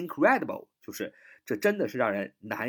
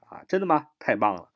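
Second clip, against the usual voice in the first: ordinary delivery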